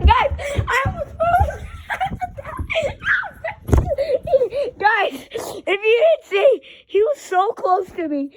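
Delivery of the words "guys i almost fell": high laughing shout. Real, not acted. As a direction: laugh through the words. Laughing after near fall.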